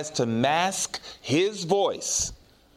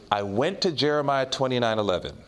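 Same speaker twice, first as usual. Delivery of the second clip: normal talking voice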